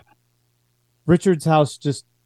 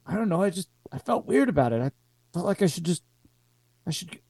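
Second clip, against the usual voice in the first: nervous private; 6 semitones higher, 5 dB quieter, much faster than usual. Acted. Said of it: "he's like".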